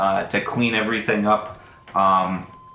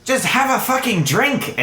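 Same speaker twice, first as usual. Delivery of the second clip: high, fed-up giving in; acted irritated snap; exasperated outburst; 12 semitones higher than usual. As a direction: give in crossly, voice pitched right up. Fed-up Pao gives in.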